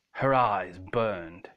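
ordinary delivery

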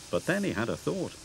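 ordinary delivery

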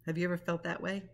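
ordinary delivery, nothing marked

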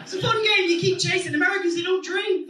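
high, lively comic rant; excited. Real, not acted. Comic boasting about drinking, high excited rant. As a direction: fire it off with glee.

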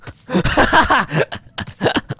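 high burst of laughter; helpless real laughter; delighted laugh. Real, not acted. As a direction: crack up at the crash. Laughing at the crash.

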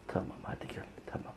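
soft whispered comfort; whispering. Real, not acted. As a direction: hush the kitten. Calming kitten.

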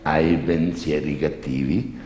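slow, prying confessor; pry slowly as the priest. Acted. Priest questions the girl.

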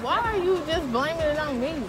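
ordinary delivery

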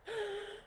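sharp high gasp, real; emotional gasp. Real, not acted. Host moved retelling the ancestor exercise, gasping. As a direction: catch the breath, moved. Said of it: "like, getting emotional".

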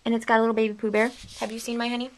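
ordinary talk, nothing marked

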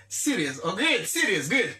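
teacher modelling the word Serious; model English word. Shown as a demonstration. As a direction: give Serious as an English word for the class to copy. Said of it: "m di serious".

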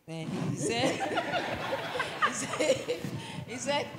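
giggling through the words; can't get it out for laughing. Real, not acted. Wife laughing as she starts retelling husband's words. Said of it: "Sister [name] is looking at you".

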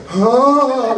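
high, drawn-out starstruck gasp; mock mind-blown squeal; stunned gasp. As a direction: gape out loud at your idol. Acts out mind-blown reaction to idol.

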